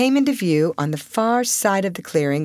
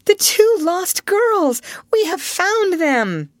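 high, drawn-out cry of discovery; excited, high-pitched exclamation; astonished cry; 13 semitones higher, 4 dB louder, slower than usual. A narrator's reading. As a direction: cry it out slowly, very high and amazed. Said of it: "found her voice and cried".

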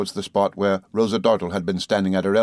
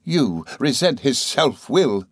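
high, slow, cutting sneer; mock the mother's pride; scornful exclamation; 5 semitones higher, slower than usual. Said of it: "she exclaimed".

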